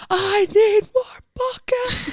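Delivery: very high, drawn-out pretend holler; mock crowd appeal. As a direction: playfully imitate calling to a whole crowd, stretched out. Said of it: "in front of the whole crowd".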